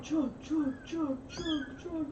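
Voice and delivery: put-on rowdy frat boy chant; frat bro chugging. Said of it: "That's my impression of Cider".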